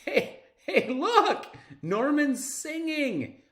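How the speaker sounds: thrilled giggly shout; laughing excitement. Acted. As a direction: burst out with delight. Excited kid interrupts lesson.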